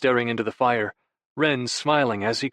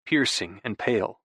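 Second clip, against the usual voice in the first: normal talking voice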